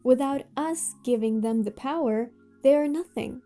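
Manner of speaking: ordinary talk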